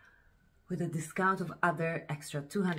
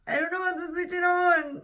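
flustered; 13 semitones higher, 5 dB louder than usual. Real, not acted. Struggling with the new camera.

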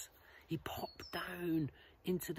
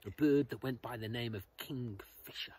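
plain reading voice

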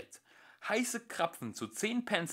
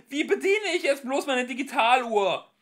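high, loud wail; despairing cry; 13 semitones higher, 12 dB louder than usual. Acted. Arthur despairing about his watch, loud and high. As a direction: wail the absurd worry.

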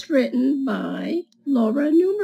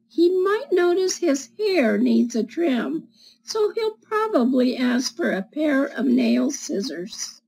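put-on reading to children; 3 semitones higher than usual. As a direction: read it like storytime for little kids. Reads picture book to children.

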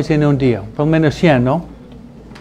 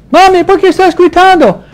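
put-on high, loud child's protest; shrill child calling out to mom; child's yelling voice; 14 semitones higher, 5 dB louder than usual. Child asking mom why she yells, high and loud.

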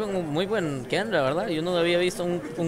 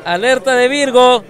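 loud laughing hype call; excited shout; 7 semitones higher, 12 dB louder than usual. Real, not acted. Yelling alert at the play.

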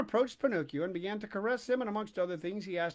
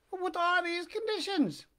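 put-on high, squeaky wooden-boy voice; high puppet voice; 11 semitones higher than usual. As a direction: pipe it up like a puppet.

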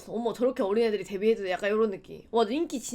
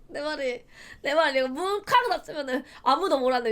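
ordinary talk, nothing marked

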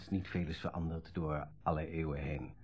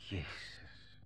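a hushed, caught-out whisper; shocked whisper; 3 semitones lower, 13 dB quieter, much slower than usual. Acted. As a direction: breathe it out, stunned. Stunned on learning Dexter read it.

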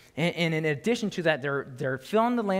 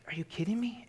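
breathy incredulous whisper; hushed mock disbelief at the people; 5 semitones higher, 6 dB quieter than usual. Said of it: "It's like".

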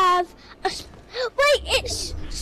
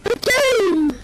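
silly shout; 3 semitones higher, 5 dB louder than usual. Pretend. Shouting a made-up word.

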